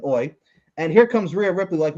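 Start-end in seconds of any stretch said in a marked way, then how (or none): 0.1-0.3 a proper Australian Oi, flat and short. Shown as a demonstration. Correcting to how Australians say it, Australian accent. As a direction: say Oi like a real Aussie. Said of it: "The proper Australian".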